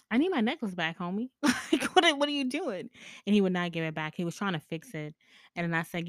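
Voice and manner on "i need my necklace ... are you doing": high-pitched, half-laughing disbelief; playful retold protest, pitched up; indignant protest. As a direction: demand it back in mock outrage, pitch climbing. Acted protest over the necklace.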